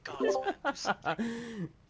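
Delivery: high, loud desperate plea; shouting. Acted. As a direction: yell at him to act. Urgent plea in combat.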